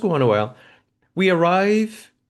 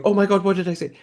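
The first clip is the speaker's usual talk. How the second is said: mock panic; 8 semitones higher, 3 dB louder, faster than usual. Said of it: "then she was like".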